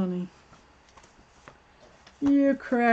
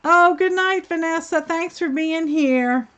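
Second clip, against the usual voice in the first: warm goodbye; 7 semitones higher, 4 dB louder than usual. Real, not acted. Saying goodbye to a viewer.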